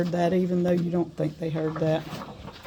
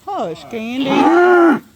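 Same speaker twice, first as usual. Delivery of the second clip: soothing hush; 8 semitones higher, 12 dB louder, much slower than usual. Real, not acted. Hushing the cow.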